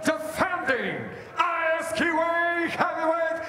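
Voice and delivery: high, drawn-out ring announcer shout; arena announcer. Real, not acted. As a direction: build and stretch to the name. Champion introduction.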